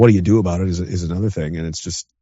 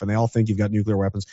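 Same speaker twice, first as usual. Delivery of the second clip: ordinary talk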